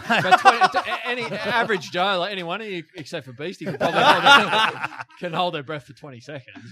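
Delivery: loud, high talk through laughter; laughing. Real, not acted. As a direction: push the words out over real laughter. Joking amid loud shared laughter.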